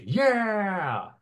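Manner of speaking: high, drawn-out crew cheer; a long high "yeah" from a rowdy crew; pirate crew cheer. Acted. Voice actor recording crowd noise, crew cheering. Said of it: "the crew goes like".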